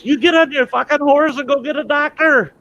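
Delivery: very high, loud screamed order; frantic yelling. Acted. Bitten Lone Ranger sends Tonto for a doctor, frantic screaming. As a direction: scream the order through acted pain.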